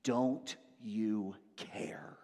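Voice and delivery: whispered, very slow "Don't you care?"; hushed accusation. Retold or imitated. Disciples whispering their accusation to Jesus. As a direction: breathe it out as a bitter whisper. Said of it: "they're direct and they're accusatory".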